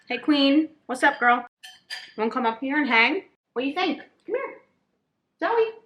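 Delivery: high sing-song voice for a pet. Real, not acted. Talking to the dog Zoe.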